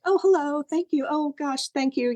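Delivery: high, delighted little hello; surprised sweet greeting. Retold or imitated. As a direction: coo at it, pleasantly surprised.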